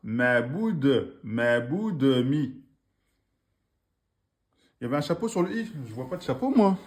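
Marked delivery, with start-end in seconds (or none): 0.1-2.6 ma bout de mi very slowly and deliberately; slow reading demo. Shown as a demonstration. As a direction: model it slowly for pupils. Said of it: "Ok, donc là".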